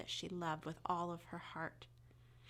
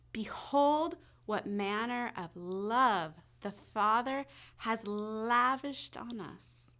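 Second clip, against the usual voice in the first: slow, louder verse recital; scripture recitation; 5 dB louder, slower than usual. Acted. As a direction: proclaim the verse with the children.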